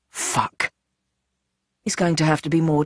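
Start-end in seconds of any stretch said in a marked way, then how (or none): 0.1-0.7 breathy, unvoiced curse; whispered curse. A narrator's reading. Captive cursing to himself in a whisper. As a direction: breathe it under your breath.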